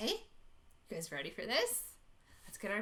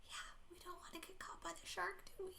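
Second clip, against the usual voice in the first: whisper; 5 semitones higher, 14 dB quieter than usual. Acted. Hiding from the shark.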